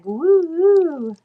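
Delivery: high happy whoop; excited whoop. Real, not acted. Cheering a sale to Felicia.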